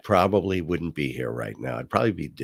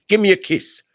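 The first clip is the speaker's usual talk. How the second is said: gunman's demand; 11 semitones higher, 8 dB louder than usual. Retold or imitated.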